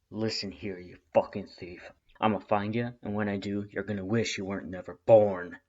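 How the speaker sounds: gruff threatening growl; Red snarling down the phone at the thief; menacing threat. Acted.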